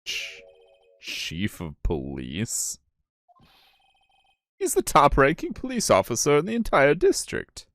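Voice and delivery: high, swooping voice of disbelief; incredulous explainer, pitched well up; astonished at the listener's ignorance, high and animated; astonished game character. Acted.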